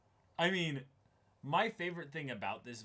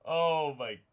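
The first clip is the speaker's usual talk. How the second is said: real laughter at a crude joke; laughing; 7 dB louder, much slower than usual. Real, not acted. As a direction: crack up, then drag it out. Cracking up at a joke.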